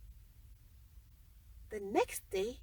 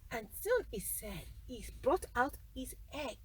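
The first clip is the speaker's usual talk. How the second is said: ordinary delivery, nothing marked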